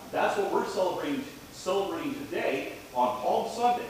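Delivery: put-on lively children's sermon voice. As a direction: talk gently to the children up front.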